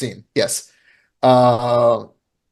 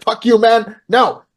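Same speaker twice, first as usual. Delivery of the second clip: angry refusal; 8 semitones higher than usual. Retold or imitated. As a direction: snap him away. Refusing to share chicken.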